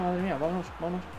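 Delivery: usual speaking voice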